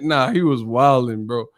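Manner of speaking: ordinary delivery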